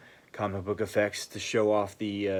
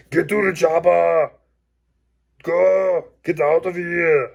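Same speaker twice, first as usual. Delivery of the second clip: put-on loud, high Schwarzenegger-style Austrian accent; Arnold Schwarzenegger impression; 9 semitones higher, 10 dB louder, slower than usual. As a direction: bark it like Arnold in an action film. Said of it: "Arnold Schwarzenegger, Scottish accent".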